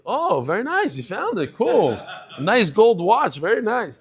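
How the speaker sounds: high, laughing mock admiration of the stolen watch; sarcastic praise. Father teasing son over a found watch, mock-impressed and laughing. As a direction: gush over it like you totally believe him.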